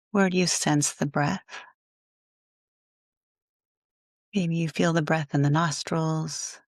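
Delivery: guided meditation. Acted. Yoga teacher guiding listeners to let go of their day, calm guided meditation. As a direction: ease them into rest, phrase by phrase.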